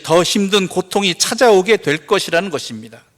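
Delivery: loud, emphatic pulpit warning; forceful preaching. Real, not acted. Preacher warning the congregation of greater suffering, forceful preaching.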